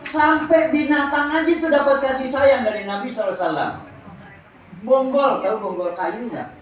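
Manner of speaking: ordinary delivery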